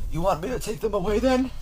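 a doting grandpa offering a treat; grandpa's voice. Acted.

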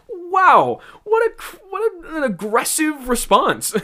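shocked. Real, not acted. Reviewer stunned by the insult, shocked and loud. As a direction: react as if slapped by the line.

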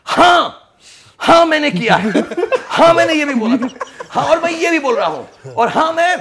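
high, loud laughing shout; yelling it out. Pretend. Actor imagining shouting his confession, loud and high. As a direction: yell it out to the world, half laughing. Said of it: "Just yell it out".

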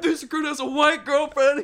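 high, very loud mock defence; laughter-strained high shout; shouting recruit. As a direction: imitate a recruit hollering his excuse.

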